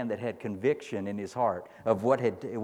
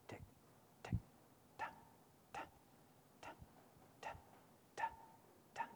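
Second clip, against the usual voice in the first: ticking watch; 24 dB quieter, much faster than usual. Retold or imitated. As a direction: tap out a muffled watch ticking. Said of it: "as a watch that was muffled by a handkerchief".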